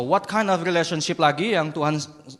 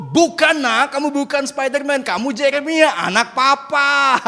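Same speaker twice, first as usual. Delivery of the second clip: loud, raised-pitch correction, drawn out at the end; exasperated father; 8 semitones higher, 7 dB louder than usual. Acted. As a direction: shout it down to your son.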